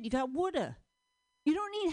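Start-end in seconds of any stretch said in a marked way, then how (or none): 0.3-0.8 "woulda" with the D stretched into uh; "woulda", wood drawn out a bit into uh. Shown as a demonstration. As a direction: pull the D of "woulda" out into a short uh. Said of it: "pull it out just a little bit".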